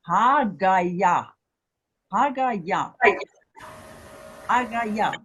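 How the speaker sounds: Hagaya said slowly, syllable by syllable, stress on the last syllable; Hebrew word demo. Shown as a demonstration. Teacher to students, modelling Hagaya for repetition, slow and clear. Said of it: "I am arriving".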